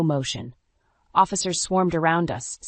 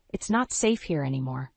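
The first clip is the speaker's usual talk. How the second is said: usual speaking voice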